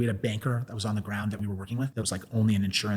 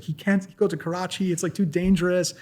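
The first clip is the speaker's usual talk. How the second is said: high, worried mother's plea; lightly acted distress; mock tearful pleading; 7 semitones higher than usual. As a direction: imitate an upset mother, pitched up without real sobs. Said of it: "she's, like, crying".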